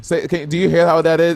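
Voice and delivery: ordinary talk, nothing marked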